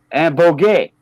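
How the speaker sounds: ordinary talk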